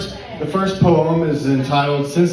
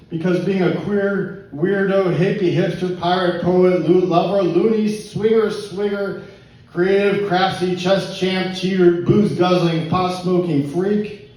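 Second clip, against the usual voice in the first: higher-pitched, rhythmic roll call; spoken-word list; 5 semitones higher, slower than usual. Acted. Poet lists misfits for pub crowd, spoken-word list. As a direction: rattle off the list as poetry, pitch held up.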